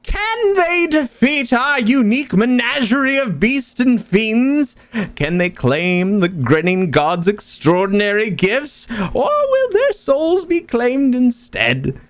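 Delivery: high, far showman announcer; bright squeaky sing-song welcome. Acted. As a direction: squeal it like a carnival host. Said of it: "He goes".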